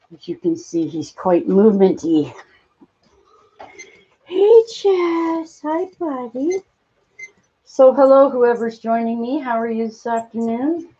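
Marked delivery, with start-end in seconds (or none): 4.2-6.6 high, slow coo. Real, not acted. Owner coos high and slowly at her chipmunk. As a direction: sweet-talk a small pet.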